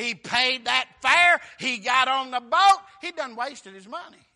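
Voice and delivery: slow, loud, punched phrases; full-force preaching. Real, not acted. Preacher recounting Jonah boarding the boat, full-force preaching. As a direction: hammer each step of the story.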